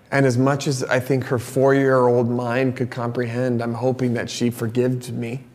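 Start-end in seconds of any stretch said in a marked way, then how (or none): none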